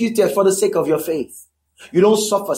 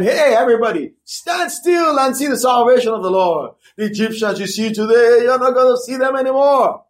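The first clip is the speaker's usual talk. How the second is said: booming Moses; 4 semitones higher than usual. Retold or imitated. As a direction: proclaim it like a great man rallying the crowd. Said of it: "sounding, you know, like a great man".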